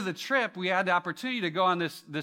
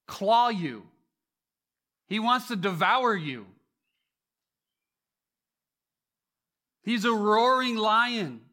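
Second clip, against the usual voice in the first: preaching at full force; 6 semitones higher, 5 dB louder than usual. Acted. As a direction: preach it, rising to a loud climax. Builds to sermon climax.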